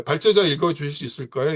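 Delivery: ordinary delivery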